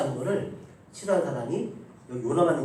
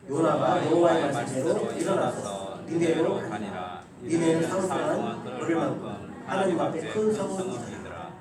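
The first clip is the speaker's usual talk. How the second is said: slow, measured unison Bible reading; scripture reading; 4 dB louder than usual. Acted. Pastor leading the church in reading Jonah, slow recitation. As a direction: read the verse slowly with the congregation. Congregation reads scripture aloud.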